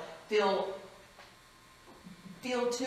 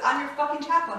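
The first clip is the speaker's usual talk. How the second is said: bold retort; 8 semitones higher, 7 dB louder than usual. Retold or imitated. Chaplain matches patient's swearing.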